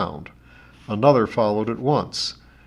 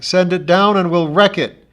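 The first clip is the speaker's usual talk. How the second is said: higher and louder defiant call; shouting back; 8 semitones higher, 8 dB louder than usual. A narrator's reading. John Thomas shouting up at the ship, defiant. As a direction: throw the threat back at them. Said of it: "John Thomas shouted back".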